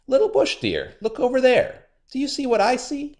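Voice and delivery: high trickster; high sly spider. A narrator's reading. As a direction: lure him to the rock. Anansi luring.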